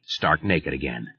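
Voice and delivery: ordinary delivery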